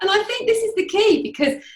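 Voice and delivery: high and loud through laughter; laughter spilling into the point; laughing. Real, not acted. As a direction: still laughing as you carry on. Laughing after shared joke.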